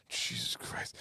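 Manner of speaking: whispered oath under the breath; exasperated whisper. Real, not acted. Muttering about the old kitchen lighting, exasperated whisper. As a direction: whisper it in disbelief.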